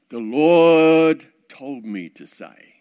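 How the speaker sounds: slow, weighty pulpit intonation, stretching the Lord; hammed-up preacher proclaiming. Mock preacher telling a crying girl why he spoke, slow and solemn. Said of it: "sounds really good when you're preaching".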